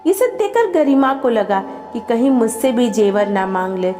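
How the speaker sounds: plain reading voice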